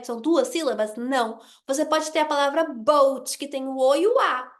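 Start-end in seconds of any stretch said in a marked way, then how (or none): none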